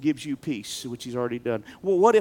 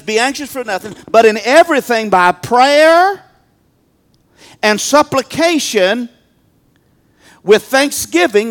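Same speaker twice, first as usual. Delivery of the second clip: preacher booming the verse; preaching scripture; 6 semitones higher, 8 dB louder than usual. Acted. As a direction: proclaim each phrase. Reading scripture with full force.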